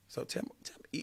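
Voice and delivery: soft breathy whisper to the date. Acted. Guy whispering to his date across the table, hushed. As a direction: lean in and whisper it.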